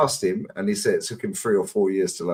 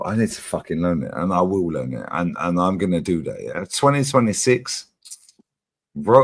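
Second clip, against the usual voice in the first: ordinary delivery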